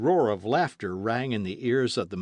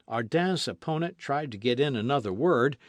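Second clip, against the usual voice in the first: plain reading voice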